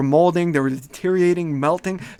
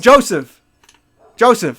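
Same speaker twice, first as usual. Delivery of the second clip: loud, raised call of a name; calling a student; 6 semitones higher, 6 dB louder, slower than usual. Pretend. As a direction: summon someone across the classroom. Professor calls student in role-play.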